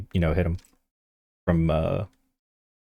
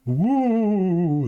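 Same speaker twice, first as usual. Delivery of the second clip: high ghostly woo; 10 semitones higher than usual. Acted. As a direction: wail it like a spooky ghost. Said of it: "why did I make a ghost sound".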